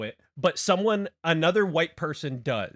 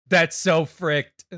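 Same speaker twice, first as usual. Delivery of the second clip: slow loud disbelief; 3 semitones higher, 9 dB louder, slower than usual. Real, not acted. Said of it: "repeats it".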